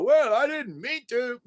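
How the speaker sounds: high-pitched, hammed-up feigned innocence; mock excuse. Mimicking someone caught on camera at the alarm, mock excuse.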